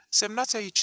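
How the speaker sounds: curt, angry and loud; angry demand from behind the door; furious. A narrator's reading. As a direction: bark the order through the door. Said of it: "spuse furios".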